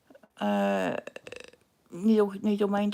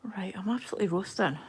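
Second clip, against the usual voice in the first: whisper; 2 semitones lower, faster than usual. Real, not acted. Muttering to herself, too hot.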